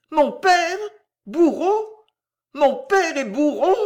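high, loud cry of horror; horrified cry. A narrator's reading. Violetta learns her father is the executioner, horrified cry. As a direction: shriek it in disbelief and dread. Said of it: "un cri d'angoisse et d'horreur".

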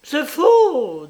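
loud, high, fierce outburst; furious high-pitched contradiction; violent denial. A narrator's reading. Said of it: "dit-elle avec violence".

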